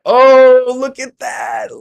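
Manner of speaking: high, loud excited outburst; excited shout. Real, not acted. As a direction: squeal it out, thrilled. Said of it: "Sorry, that's exciting".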